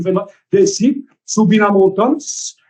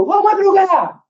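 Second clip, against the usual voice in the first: high mock call from afar; calling out across the street; 13 semitones higher, much slower than usual. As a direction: hail a friend from the other side of the road. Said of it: "aí o cara gritou". (chamando de longe, voz aguda)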